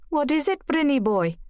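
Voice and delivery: broad mock Welsh, sing-song and high; exaggerated Welsh accent. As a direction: lay the Welsh on thick to rib her husband. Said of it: "She exaggerated her Welsh accent".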